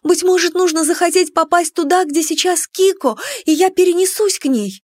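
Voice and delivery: high, urgent, hopeful pleading. A narrator's reading. Narrator pleads for lost friend. (умоляюще, высоким голосом)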